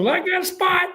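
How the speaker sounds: high-pitched offhand offer; Coach Spurrier impression. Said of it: "That's how he talks".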